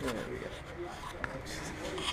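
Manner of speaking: slow whisper; hushed, slow and breathy. Real, not acted. Whispers in tyre shop.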